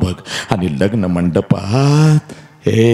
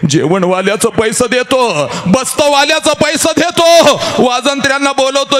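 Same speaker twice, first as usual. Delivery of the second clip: 1 full-force preaching. Acted. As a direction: drive it like a kirtan peak.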